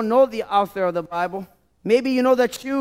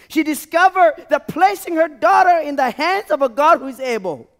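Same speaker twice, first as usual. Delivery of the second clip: higher, louder, building preacher's cry; fiery preaching; 5 semitones higher, 4 dB louder than usual. Acted. Preacher driving home the mother's story, loud and rising. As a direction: preach it out to the church at full force.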